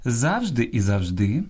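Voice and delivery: завжди stressed first on ЗАВ, then on ДИ, slow; завжди shown with first, then last syllable stressed; double stress. Shown as a demonstration. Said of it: "мають подвійний наголос".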